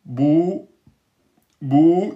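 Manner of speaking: bou held for two beats; teacher showing a long vowel. Shown as a demonstration. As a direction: stretch the ou in bou. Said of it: "deux temps".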